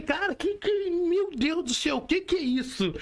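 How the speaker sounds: voz bem mais aguda e lenta, de choque exagerado; espanto fingido. Retold or imitated. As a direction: imita o próprio susto ao ouvir siglas estranhas. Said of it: "Eu falei". (espanto cômico, voz aguda e arrastada)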